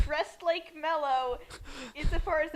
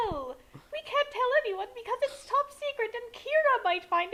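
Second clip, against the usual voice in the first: higher, earnest parent explaining; a lightly voiced father with a raised pitch; raised mock father voice; 7 semitones higher than usual. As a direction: explain it like an anxious dad. Voiced as Frank Einstein.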